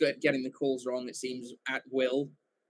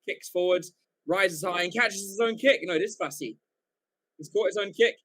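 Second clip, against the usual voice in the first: rising, louder commentary as the try unfolds; excited play-by-play; 6 semitones higher, 5 dB louder than usual. Acted. Commentating the try live to the stream, excited play-by-play. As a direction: call the break higher and louder line by line.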